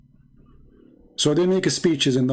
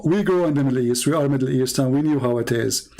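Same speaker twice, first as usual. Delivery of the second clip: ordinary talk, nothing marked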